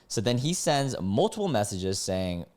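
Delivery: ordinary talk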